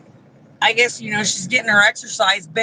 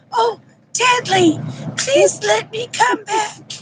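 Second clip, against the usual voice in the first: much higher, whiny mock begging; mocking plea; 11 semitones higher than usual. Mimicking Kyra begging Deadly in a high whine. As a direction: whine it like a pathetic fan. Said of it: "She's like".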